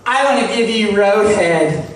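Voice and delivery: ordinary delivery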